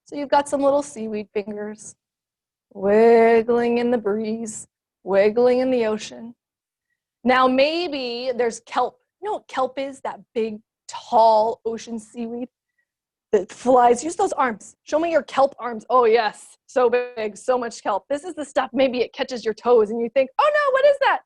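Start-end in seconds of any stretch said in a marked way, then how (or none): 2.7-6.3 slow, drawn-out, dreamy; slow swaying. Shown as a demonstration. Teacher guiding kids' seaweed fingers, slow swaying voice. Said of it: "like they're seaweed". 20.4-21.2 high, loud pretend alarm at the kelp; mock fright. Teacher acting startled by kelp at her toes, mock fright.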